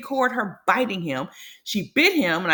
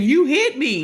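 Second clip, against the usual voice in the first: a louder, higher mock accusation; accusing cry; 4 semitones higher, 6 dB louder than usual. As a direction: retell her accusing him, voice pushed up. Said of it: "And she's saying".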